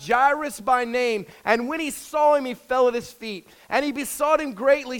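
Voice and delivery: scripture reading. Acted. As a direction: read the King James text out to the church. Reads scripture aloud.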